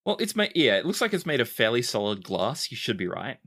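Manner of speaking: usual speaking voice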